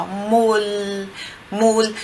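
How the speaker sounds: Mul with the tongue tip behind the front teeth; Italian L. Shown as a demonstration. Teacher modelling the Italian final L, slowly. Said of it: "ele tem esse som aqui".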